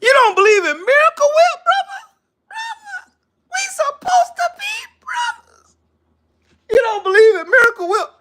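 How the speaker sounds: very high, squealing mock cry; mock-sobbing falsetto protest; hammed-up tearful disbelief. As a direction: wail it in wounded betrayal, pitch through the roof. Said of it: "I'm about to cry in the car".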